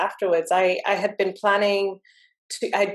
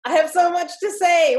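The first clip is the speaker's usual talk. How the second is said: excited rush; 10 semitones higher, 4 dB louder than usual. Retold or imitated. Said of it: "It was like".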